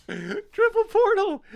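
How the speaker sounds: high, laughing delight; laughing triumph over a triple portal; excited squeal. Real, not acted. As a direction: squeal it out in glee.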